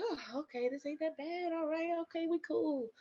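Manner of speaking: quietly telling yourself it's fine; downplaying it. Mom-to-be shrugging off early contractions, softly reassuring herself.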